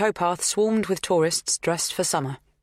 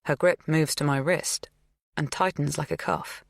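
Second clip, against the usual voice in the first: ordinary delivery, nothing marked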